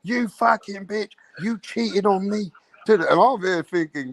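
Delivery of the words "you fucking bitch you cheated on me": high, slow, imitated screaming fury; berserk rage. Imitating the girlfriend going berserk at her cheating partner, high slow fury. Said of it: "She's going to berserk".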